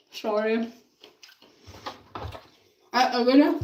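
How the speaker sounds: usual speaking voice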